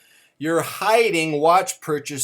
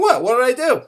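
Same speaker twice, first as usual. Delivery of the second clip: high-pitched, louder feigned bewilderment; playing innocent; 10 semitones higher, 8 dB louder, faster than usual. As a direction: protest innocence in a squeaked-up voice. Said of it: "You're playing innocent".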